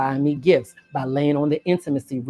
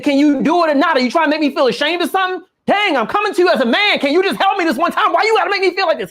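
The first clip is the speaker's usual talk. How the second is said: higher, louder indignant rant; mock angry outburst; 10 semitones higher, 4 dB louder, faster than usual. Boyfriend blowing up when asked for rent, mock angry rant. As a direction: get defensive and blow up at her. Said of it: "He goes off on me".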